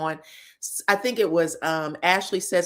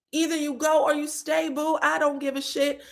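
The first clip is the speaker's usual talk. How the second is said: fed-up husband; 7 semitones higher than usual. Retold or imitated. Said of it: "And he went".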